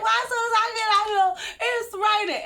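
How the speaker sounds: high, loud, fast mock-sob complaint; hammed-up crying texted to a friend; mock crying whine. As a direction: whine like a wronged kid. Whining text to friend about rain.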